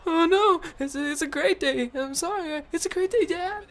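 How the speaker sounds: very high, panicky mock pleading; scared kid. Imitating his friend apologizing to angry dad, high scared squeal. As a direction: squeak it out as a frightened child backtracking. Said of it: "John got scared".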